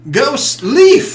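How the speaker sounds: high, rising call. Acted. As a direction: call sharply for someone across the room. Calling out to the ghost.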